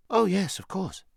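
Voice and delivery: put-on high, eager boy's reply; high-voiced, ready assurance. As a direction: pipe up quickly as a keen boy. Boy hastily claims he can ride.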